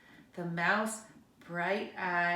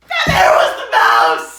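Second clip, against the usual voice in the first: much lower, much louder shouted reveal; 10 semitones lower, 21 dB louder than usual. A narrator's reading. Reading to kids, booming surprise reveal. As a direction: boom out the surprise.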